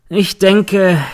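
höher und lauter, spöttisch angesetzt; sarkastisch. A narrator's reading. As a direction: setz beißenden Sarkasmus an. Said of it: "meint der Verteidiger sarkastisch". (sarkastisch, höher und lauter)

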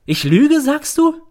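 very high, loud incredulous question; indignant. A narrator's reading. Mother confronting her accusing son, indignant. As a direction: challenge it in outrage.

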